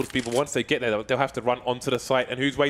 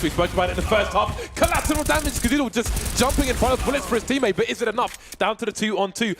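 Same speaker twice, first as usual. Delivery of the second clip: excited play-by-play; 8 semitones higher than usual. Real, not acted. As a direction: ride the chaos, voice soaring. Caster builds over collateral kill.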